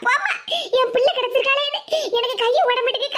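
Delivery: normal talking voice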